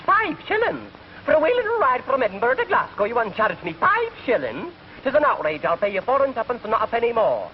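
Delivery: high, indignant Scottish brogue; outraged Scot haggling over the fare; Scottish accent. Retold or imitated. Scot on a train protesting to the conductor, indignant Scottish accent. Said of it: "And Scott says".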